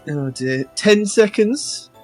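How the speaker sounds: ordinary talk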